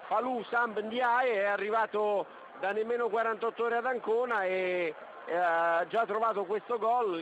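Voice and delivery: usual speaking voice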